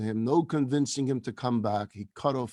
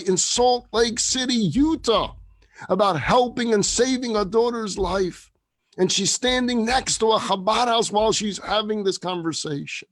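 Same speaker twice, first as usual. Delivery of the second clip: loud, raised, emphatic sermon voice; impassioned preaching; 4 semitones higher, 5 dB louder than usual. Real, not acted. Rabbi urges listeners to go find them, preaching with force. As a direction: build to a ringing, drawn-out exhortation.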